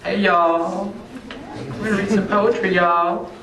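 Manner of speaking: high-pitched, put-on joke voice; put-on y'all voice. Poet greeting his audience as a joke, put-on high voice. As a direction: greet the crowd in a silly high voice. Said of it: "that's not really my voice".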